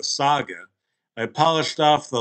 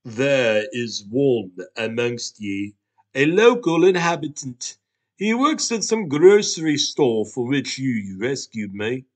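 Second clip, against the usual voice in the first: grave talking Hot Pocket; 5 dB louder than usual. Acted. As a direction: proclaim it like an ancient oracle. Hot Pocket describes the clerk.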